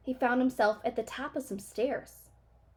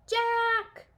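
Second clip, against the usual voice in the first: very high, loud frightened cry; loud panicked cries; cry for help; 13 semitones higher, 6 dB louder than usual. A narrator's reading. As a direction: call for help from far away. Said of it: "Her voice was louder".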